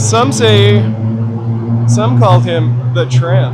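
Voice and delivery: loud, slow movie-trailer announcer. Pretend. Vlogger booming a mock trailer intro about Chaplin. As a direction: boom it out like a trailer voiceover.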